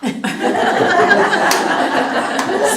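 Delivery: a loud, high burst of real laughter; bright laugh. Real, not acted. Laughing aloud at the doctor's surprise, loud high laugh.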